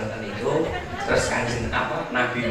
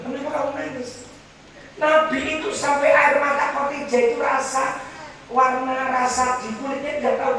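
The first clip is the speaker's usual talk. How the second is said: high, strained preaching voice; preacher pleading in a high voice; impassioned storytelling; 14 semitones higher than usual. Acted. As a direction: tell it with rising emotion. Emotional high storytelling climax.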